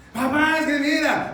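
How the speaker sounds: put-on high, loud pleading kid; insistent child. Child arguing with dad, insistent pleading.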